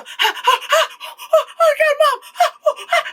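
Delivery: high mock screaming and gasping; hammed-up yelps of a terrified girl; imitated panicked screams. Daughter screaming for her mom behind the drywall, mock panic.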